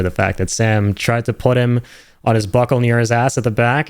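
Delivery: ordinary delivery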